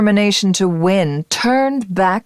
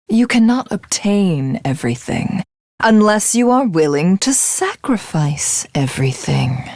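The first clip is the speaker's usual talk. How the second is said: ordinary talk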